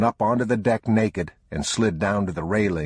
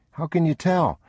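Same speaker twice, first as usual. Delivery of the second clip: put-on much higher, curious child's voice; young boy; 11 semitones higher than usual. A narrator's reading. Boy asking his father about the rain. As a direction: ask like a wondering small boy. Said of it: "the boy said".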